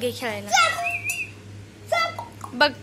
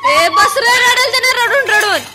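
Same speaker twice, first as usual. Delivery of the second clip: much higher, louder scolding call; sharp scolding shout; 8 semitones higher, 8 dB louder than usual. Real, not acted. Scolding a crying toddler.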